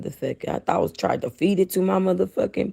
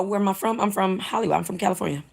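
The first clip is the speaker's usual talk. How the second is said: ordinary talk, nothing marked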